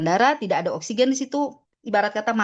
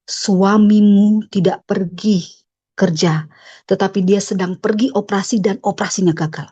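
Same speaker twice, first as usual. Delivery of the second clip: God speaking; 5 dB louder than usual. Retold or imitated. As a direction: voice lightly as God delivering a revelation. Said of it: "Tuhan berbicara begini".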